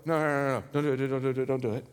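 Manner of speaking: low, rapid-fire mock pleading; string the protests together, low and urgent; crowd pleading no. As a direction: lightly imitate a worried crowd's protests. Acting crowd begging him to stop.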